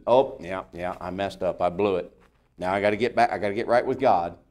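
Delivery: owning up with a touch of defeat; mild sheepish admission. Acted. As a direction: play a deflated believer, lightly. Acts out the believer who slipped up.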